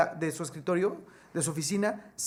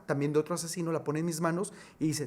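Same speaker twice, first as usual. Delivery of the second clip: ordinary talk, nothing marked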